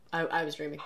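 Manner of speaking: soft groggy mumble; half asleep. Retold or imitated. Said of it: "he's like".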